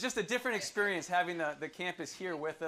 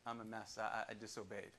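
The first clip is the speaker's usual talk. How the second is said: lower, much softer broken confession; contrite Jonah; 8 semitones lower, 4 dB quieter than usual. Retold or imitated. Jonah confessing to God at rock bottom, contrite and soft. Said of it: "He's like".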